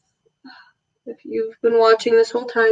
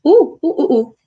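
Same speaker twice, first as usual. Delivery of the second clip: startled; 4 semitones higher, 6 dB louder than usual. Real, not acted. Thought something got in eye.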